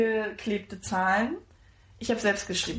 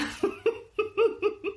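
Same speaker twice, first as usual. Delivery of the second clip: delighted laugh; 12 semitones higher than usual. Real, not acted. Gift reaction, laughing.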